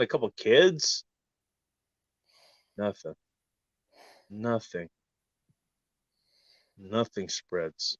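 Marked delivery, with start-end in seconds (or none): 2.8-8.0 much softer, slow ominous hush; a quiet, ominous hush; hushed dramatic. Acted. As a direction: drop it to a near-hush for drama. Dramatic hushed rant build-up.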